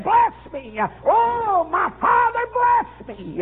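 preacher voicing Esau's desperate plea; pleading son. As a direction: beg for the blessing, voice climbing high. Esau begging his father's blessing.

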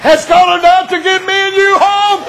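high, shouted revival preaching; preaching at full force. Acted. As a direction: cry it out to the congregation.

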